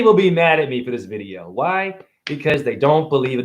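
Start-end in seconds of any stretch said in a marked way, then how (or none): none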